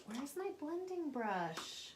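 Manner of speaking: high, drawn-out searching call; searching impatiently. Real, not acted. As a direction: stretch it out while hunting for something. Hunting for a missing brush.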